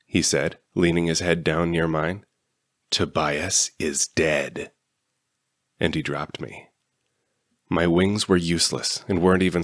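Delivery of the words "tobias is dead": slow, low, sinister villain voice; menacing secret. A narrator's reading. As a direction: drag out the gloating secret. Villain whispers secret before drop.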